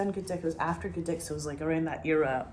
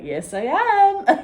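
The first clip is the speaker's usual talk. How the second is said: much higher, louder, slow beaming yes; overeager yes; 13 semitones higher, 11 dB louder, much slower than usual. Retold or imitated. Young campaigner answering old no voters, hammed-up eager shout. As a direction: drag it out, bursting with keen energy. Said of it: "I had so much fucking energy".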